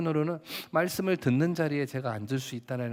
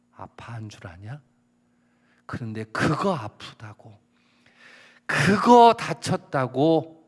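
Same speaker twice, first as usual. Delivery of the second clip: scolding the student, bursting into shouts; stern tiger pastor; 8 dB louder than usual. Retold or imitated. Pastor scolding a quitting student, shouting angrily. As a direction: roar at him like a furious tiger pastor. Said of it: "제가 호랑이 목사였어요".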